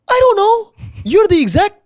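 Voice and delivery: yelling at a boss who passes the buck; angry yell. Retold or imitated. Yelling at Chip on the phone, furious and loud. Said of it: "I was pissed, like, super pissed".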